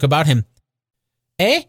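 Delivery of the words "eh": put-on puzzled little boy's query; a boy's baffled response; wondering boy. A narrator's reading. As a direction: ask in startled wonder. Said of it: "with all his might of wonder".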